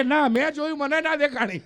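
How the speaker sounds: friend's loud reply. Retold or imitated. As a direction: answer loudly, innocent and straight. Friend answers loudly.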